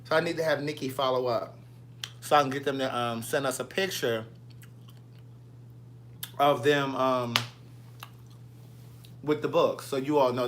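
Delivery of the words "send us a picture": ordinary talk, nothing marked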